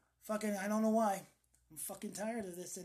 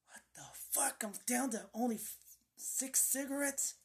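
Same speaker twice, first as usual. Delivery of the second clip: higher, strained frustrated outburst; retold frustration; 5 semitones higher than usual. Smoker finding only six cigarettes left, frustrated. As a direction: act out exasperation under your breath. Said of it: "I, I'm getting frustrated".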